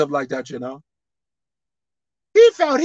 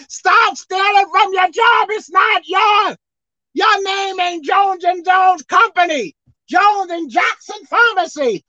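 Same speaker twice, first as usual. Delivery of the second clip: loud, higher shouted rebuke from the pulpit; preaching hard; 11 semitones higher, 6 dB louder than usual. Acted. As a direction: drive it home, shouting at the stealers.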